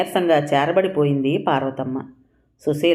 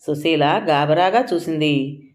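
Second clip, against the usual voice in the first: ordinary delivery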